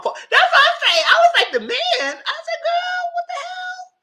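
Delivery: high, loud, squealing through laughter; laughing disbelief. Real, not acted. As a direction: crack up in incredulous, high-pitched protest. Laughing disbelief at the men reference.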